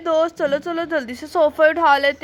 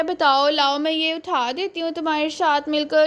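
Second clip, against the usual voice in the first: usual speaking voice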